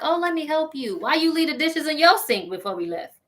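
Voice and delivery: high-pitched fussy sweetness, imitated; mock helpful girlfriend. Mocking a woman playing housekeeper at his house, high and fussy. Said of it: "walk around his house like".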